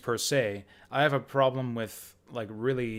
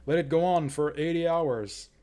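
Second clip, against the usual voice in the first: higher, louder, stretched-out plea for more; eager begging; 4 semitones higher, 5 dB louder than usual. Pretend. Player imagining loving a long game, eager begging. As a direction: beg greedily for more, voice up. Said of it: "I'd be like".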